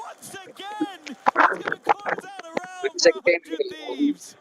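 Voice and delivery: rising to an excited high shout; esports caster. Real, not acted. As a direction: build the hype to a clutch win. Live play-by-play of a clutch.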